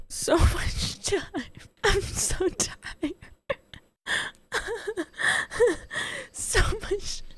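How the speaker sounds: high, shaky relief; overwhelmed, almost crying; tearful relief; relieved near tears. Real, not acted.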